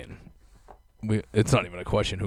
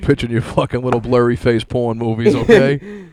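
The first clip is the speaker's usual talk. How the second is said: mock studio boss; 5 dB louder than usual. Pretending to be an old studio mogul berating a pitch, in a mock loud tirade. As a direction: bark it like a crude studio mogul. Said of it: "I could say".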